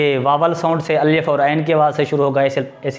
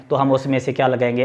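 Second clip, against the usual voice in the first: usual speaking voice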